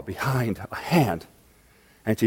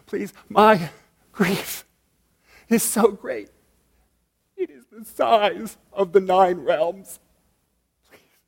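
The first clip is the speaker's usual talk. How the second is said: pleading; 7 semitones higher than usual. Acted. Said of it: "to her sister and pleaded".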